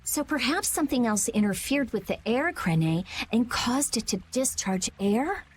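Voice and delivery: ordinary talk, nothing marked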